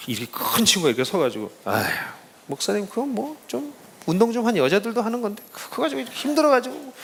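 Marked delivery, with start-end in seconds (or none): 1.6-6.9 teasing. Retold or imitated. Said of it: "날 놀리는 거야".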